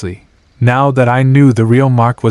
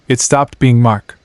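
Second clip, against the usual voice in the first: ordinary delivery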